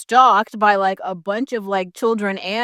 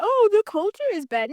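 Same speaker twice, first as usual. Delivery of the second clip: higher, mocking imitation of a complaint; mock quote; 10 semitones higher than usual. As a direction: parrot their line back mockingly. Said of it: "you're like".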